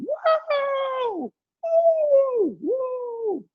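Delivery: very high, drawn-out mock screams; imitated screaming. Preacher mimicking terrified cinema audience, high mock screams. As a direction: scream like being slaughtered, for comic effect. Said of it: "Orang lain sudah teriak kayak disembelih".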